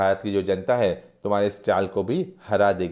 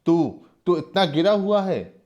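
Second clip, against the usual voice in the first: slow, higher furious outburst; acted angry cry, rising high at the end; angry shout; 4 semitones higher, slower than usual. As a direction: hurl the insults in rage. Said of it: "Sagarmal गुस्से से चीख कर कहते हैं".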